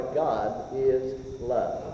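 very slow, low and soft; slow tender quote. Acted. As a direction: draw each word out tenderly. Savoring sweetest words.

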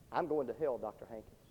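hushed last words, almost gone; dying boy's last words. Retold or imitated.

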